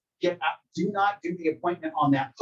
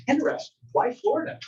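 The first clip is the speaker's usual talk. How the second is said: curious agent; 10 semitones higher than usual. Retold or imitated. Agent probing client, role-played.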